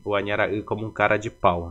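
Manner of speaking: ordinary talk, nothing marked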